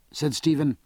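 plain reading voice

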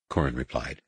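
usual speaking voice

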